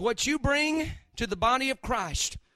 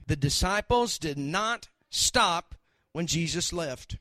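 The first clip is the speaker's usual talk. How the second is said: ordinary delivery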